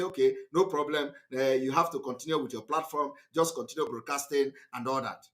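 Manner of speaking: low, brisk dismissive officials; mock officials. Officials waving off his platform, low and brisk. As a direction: rattle it off like bored officials.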